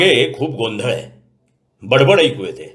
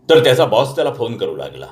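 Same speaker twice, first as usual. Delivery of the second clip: usual speaking voice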